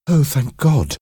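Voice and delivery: high cry of relief; relieved exclamation. A narrator's reading. Mother hearing her son feels no pain, exclaiming. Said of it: "Mary exclaimed".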